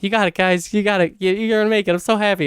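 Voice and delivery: root for them like a proud parent; emotional cheering-on. Retold or imitated. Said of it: "this weird, like, emotional".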